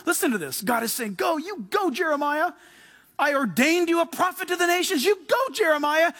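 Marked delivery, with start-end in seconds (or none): 1.2-6.1 higher, emphatic send-off; urging command. Acted. God sending Jeremiah to the nations, high urging command. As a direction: spur a young man on, pitch lifted.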